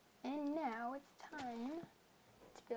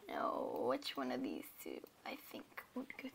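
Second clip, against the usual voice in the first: whispering; 11 semitones lower, 6 dB quieter than usual. Real, not acted. Whispered musing while building.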